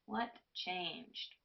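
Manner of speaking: coaxing the class, dropping the voice; soft repeat. Real, not acted. Teacher softly re-asks the silent class.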